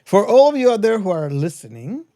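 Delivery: normal talking voice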